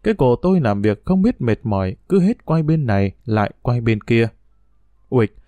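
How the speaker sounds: usual speaking voice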